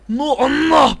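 loud, high exasperated yell; real frustrated outburst; frustrated shout. Real, not acted. Reacting to missed chance.

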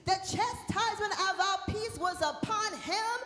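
emphatic, declaimed Bible reading; scripture proclamation. Real, not acted. Preacher leads congregation in reading Isaiah, declaimed. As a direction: declaim the verse for all to follow.

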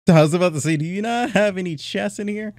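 high, loud, eager; thrilled at the loot; excited. Real, not acted. Gamer finds villagers with arrows, excited.

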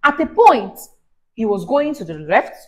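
plain reading voice

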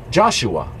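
ordinary delivery

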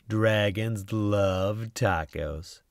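slow, savoured title line; slow refrain. A narrator's reading. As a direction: linger on each word.

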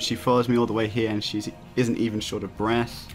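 ordinary delivery

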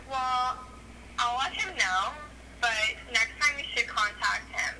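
usual speaking voice

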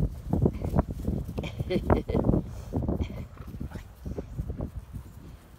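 long unvoiced laugh. Real, not acted. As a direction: laugh it out helplessly. Laughing at the dogs.

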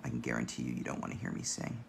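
low, rough and gravelly. Real, not acted. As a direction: talk through a gravelly throat. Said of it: "my voice is very gravelly today".

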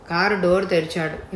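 plain reading voice

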